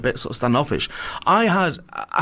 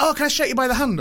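thrilled fan; 11 semitones higher than usual. Retold or imitated. Clerk asking to shake hands.

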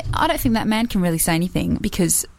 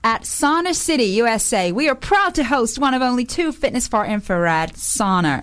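ad read; 4 semitones higher than usual. Pretend. Reading advert in ad voice.

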